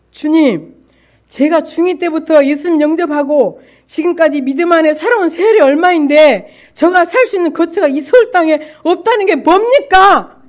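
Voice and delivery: loud, high-pitched protest to God; confronting God. Retold or imitated. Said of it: "하나님을 따지기 시작했어요".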